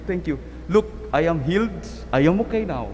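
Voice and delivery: normal talking voice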